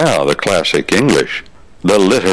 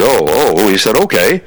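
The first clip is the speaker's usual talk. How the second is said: eager Okay, pitched up; bright, eager agreement; excited kid; 3 semitones higher than usual. Retold or imitated. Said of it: "all excited".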